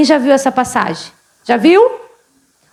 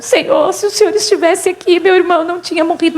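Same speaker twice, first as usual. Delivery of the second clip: higher-pitched plaintive reproach, hurrying at the end; mildly mimicked weeping; 3 semitones higher than usual. Martha tells Jesus of her dead brother, mildly mimicked weeping. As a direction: lightly imitate a tearful plea. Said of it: "Aí a Marta chorando".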